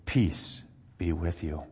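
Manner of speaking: "Peace be with you" said slow, soft and low; soft greeting. Acted. Jesus appears in the locked room, slow and hushed. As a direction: breathe "Peace be with you" out slowly and quietly.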